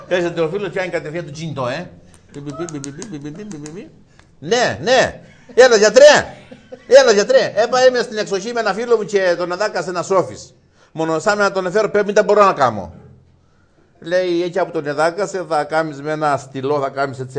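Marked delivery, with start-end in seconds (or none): 2.3-3.9 mock dial tone beeps, fading; phone beeps. Imitating phone ringing/dialing. 4.4-12.9 high, loud, urgent phone call; frantic on the phone to the doctor; panicked call. Acted.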